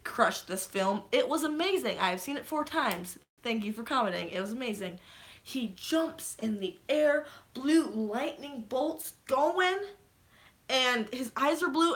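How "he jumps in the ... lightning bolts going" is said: slow awed retelling. Real, not acted. Retelling the climax again slowly.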